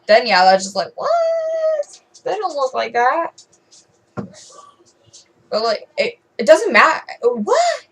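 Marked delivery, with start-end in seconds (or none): 1.0-3.5 incredulous what. Retold or imitated. Mocking disbelief at hater's claim. 7.2-7.9 incredulous what. Real, not acted. Exasperated disbelief at critics.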